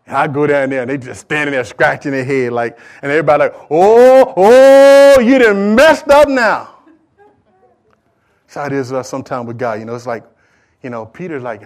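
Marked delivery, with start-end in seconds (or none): 3.7-6.8 high, loud, drawn-out "ohhh" of trouble; mock-ominous taunt. Preacher imitating kids watching the culprit, loud teasing warning. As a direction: crow that someone is in big trouble. Family reacting to child's big trouble.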